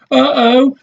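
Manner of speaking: put-on a child's worried uh-oh; Jill. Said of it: "says Jill".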